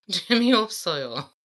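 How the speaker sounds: giggling through the answer; laughing, slightly fast. Real, not acted. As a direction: let the laugh break into the word. Laughs while reading answer.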